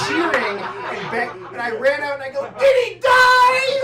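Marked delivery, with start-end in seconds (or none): 2.6-3.8 much higher, louder, slow hopeful cry; excited shout. Retold or imitated. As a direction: yell it out full of wild hope. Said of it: "I was so excited".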